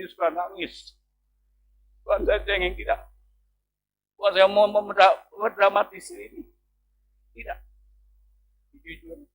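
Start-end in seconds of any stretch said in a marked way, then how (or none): none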